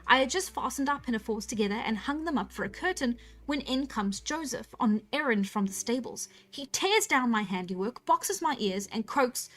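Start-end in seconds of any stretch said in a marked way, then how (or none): none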